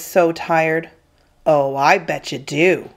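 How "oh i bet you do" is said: sneering. Acted. Bully doubting the kid has no money, sneering. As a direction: sneer it with knowing menace. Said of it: "the kid sneered".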